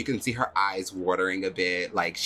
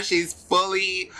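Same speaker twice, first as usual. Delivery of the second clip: ordinary talk, nothing marked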